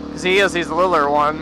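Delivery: ordinary talk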